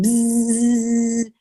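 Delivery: high, loud imitated buzz; loud bee screech.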